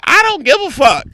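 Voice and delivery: loud, very high-pitched retort; retold angry dismissal. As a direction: fling it back, reliving the exasperation. Acts own blunt phone reply.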